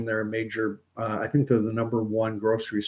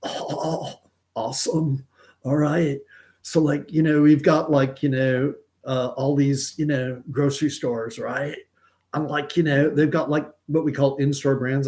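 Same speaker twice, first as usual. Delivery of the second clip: higher-pitched, put-on Californian dude voice; hammed-up laid-back California talk; California accent; 4 semitones higher than usual. Shown as a demonstration. Streamer revives his old impression for viewers, California accent. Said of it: "since I've done my California".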